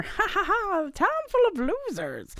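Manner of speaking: high, gloating villain cackle running into the words; imitating the Grinch. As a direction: crow over everyone with a stagey laugh. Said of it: "because he's like".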